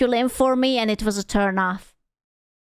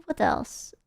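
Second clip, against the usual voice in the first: ordinary talk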